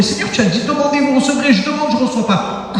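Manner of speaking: high-pitched, insistent complaint; frustrated plea. Acted. Believer complaining to the Lord about unanswered prayer, frustrated plea. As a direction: plead upwards, pressing the point.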